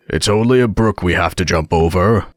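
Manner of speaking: lightly voiced hoarse horse; husky horse, calmly explaining. A narrator's reading. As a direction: reassure the passengers in a horse's hoarse voice. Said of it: "quietly drew it in and said".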